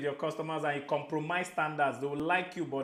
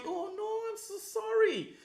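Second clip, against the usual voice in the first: gushing apology. Shown as a demonstration. Showing customers how to say sorry, high gushing apology. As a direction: melt into a pleading sorry, pitched way up. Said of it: "it sounds in the tone of your voice".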